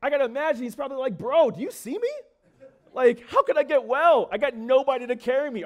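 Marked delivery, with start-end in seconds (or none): 1.2-5.6 loud, high, incredulous comeback; hammed-up indignant protest, pitched up; mock attitude. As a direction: throw it back with exaggerated attitude. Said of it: "He probably had some attitude".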